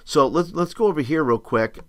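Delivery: ordinary talk, nothing marked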